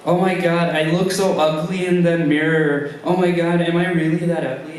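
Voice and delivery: imitating a tearful panic, hammed-up; mock crying. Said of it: "came to my other friend crying".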